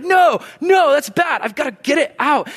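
high, loud, alarmed "no"; urgent refusal. Acted. Preacher acting out hardcore rejection of sin, high urgent cry.

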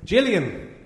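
put-on Gillian in a hammy Scottish accent; bad Scottish accent. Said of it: "Terrible Scottish accent".

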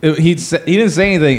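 normal talking voice

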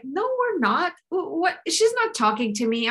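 high-pitched mock disbelief; acted incredulous protest, starting high; balking personal assistant. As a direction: play an assistant refusing to believe it. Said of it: "the personal assistant is like".

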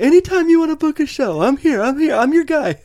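very high-pitched imitation of an eager owner; eager bar owner. Won-over bar owner offers to book shows, hammed-up high eagerness. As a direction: gush it in a high mock voice. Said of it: "It's just like".

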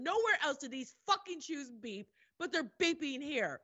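high, exasperated retold tirade; indignant rant. Traveler whose shoes beep at security, indignant rant. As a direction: rail at the absurdity, punching the swearing.

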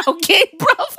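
high, laugh-filled check-in; cracking up on high words. Real, not acted. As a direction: speak through a burst of laughter. Laughing at own rant.

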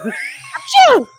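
loud, high-pitched fake sneeze; mock sneeze. Co-host finishing her friend's sneeze gag, mock sneeze. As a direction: burst out with a big put-on achoo. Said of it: "[name] sneezes her way into this conversation".

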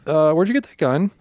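high, loud alarmed asking; woman spotting a real gun, panicking; panicked question. Retold or imitated. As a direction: blurt it out, suddenly scared. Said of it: "she looks actually panicked".

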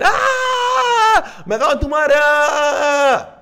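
put-on high-pitched screaming kid's outburst; acted tantrum scream. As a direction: shriek like a kid losing it in class. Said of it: "y de repente empieza a gritar". (grito de niño en pleno berrinche)

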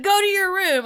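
stern parent order; firm parent command. Retold or imitated. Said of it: "great job. Like, excellent delivery".

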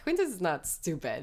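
ordinary talk

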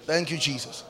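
low, soft thanks to Jesus; hushed devotional tone; quiet prayer. Real, not acted. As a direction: pray it softly and reverently, voice dropped low. Prays quietly during congregational worship.

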